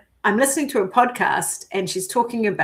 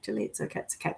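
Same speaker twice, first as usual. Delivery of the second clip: whispered, gentle reassurance to herself; softly calming her own panic in a whisper; self-soothing whisper; 4 semitones lower, 12 dB quieter, much faster than usual. Retold or imitated. Retelling how she whispered comfort to herself at night, hushed and tender.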